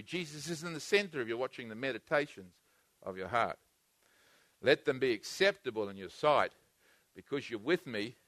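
a quiet, reassuring preacher's murmur; intimate hush. Real, not acted. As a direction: lean in and let it drop to a near hush.

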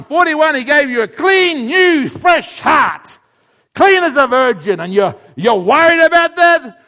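loud, high, heated storytelling sermon; full-force preaching. Acted. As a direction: build the story up to the congregation.

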